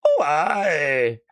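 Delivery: put-on Scottish accent with a laugh; mock Glaswegian local, half laughing.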